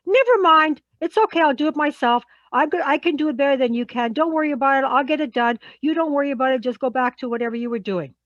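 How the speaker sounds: exasperated. Acted. Fed-up colleague taking the job back, exasperated. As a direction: take over impatiently, starting high and loud. Acts out exasperated takeover of task.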